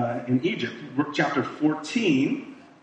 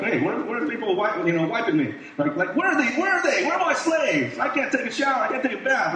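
pampered prince; 7 semitones higher, faster than usual. Retold or imitated. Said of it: "He was like".

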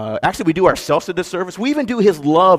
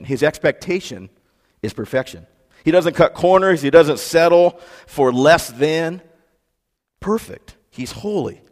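normal talking voice

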